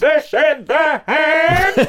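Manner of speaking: very high, slow, loud grating robot; Dalek voice. Pretend. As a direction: screech it out like a Dalek. Dalek voice continues.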